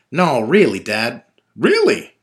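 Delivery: loud, high disbelief; can't believe what Dad says; incredulous. Acted. Son disbelieving his father's story, loud and high.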